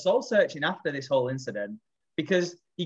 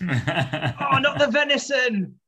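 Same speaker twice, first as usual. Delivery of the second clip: high, slow, laughing wail; mock dismayed shout; 9 semitones higher, 4 dB louder, much slower than usual. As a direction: cry out in hammed-up despair, dragging it out. Parodies the shouted meat quote.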